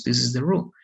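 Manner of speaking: ordinary delivery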